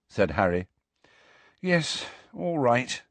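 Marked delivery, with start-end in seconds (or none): 1.6-3.0 slow, softer, tired agreement; weary. A narrator's reading. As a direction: sigh it out, drained. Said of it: "said Hermione wearily".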